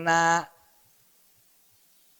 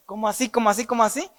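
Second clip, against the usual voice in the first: baffled commander; 6 semitones higher, much faster than usual. Acted. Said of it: "el comandante se quedó".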